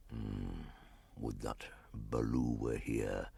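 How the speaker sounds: slow, soft, low panther voice; a big cat murmuring a worried wish. Acted.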